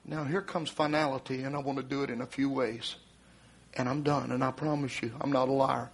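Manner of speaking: low, measured warning; solemn preaching. Real, not acted. Preacher warns congregation of the coming flood, solemn and low. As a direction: bring it down low and grave, with long pauses.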